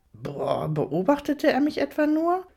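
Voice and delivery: indignant question climbing high; affronted disbelief, pitch soaring. A narrator's reading. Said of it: "atmete laut und empört aus".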